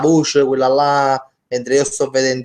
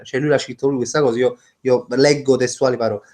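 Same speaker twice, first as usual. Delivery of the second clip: normal talking voice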